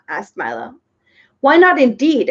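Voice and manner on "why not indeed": loud, eager exclaim. A narrator's reading. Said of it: "exclaimed the bug".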